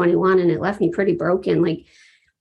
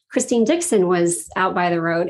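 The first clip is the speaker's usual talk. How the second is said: ordinary delivery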